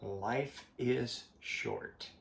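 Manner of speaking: very slow, each word weighed; slow and deliberate. Shown as a demonstration. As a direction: drag it out so it sinks in. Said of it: "just realize".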